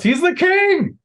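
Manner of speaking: very high, loud cry from the crowd; shout. Acted. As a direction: proclaim it at the top of your voice. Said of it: "But then someone shouts".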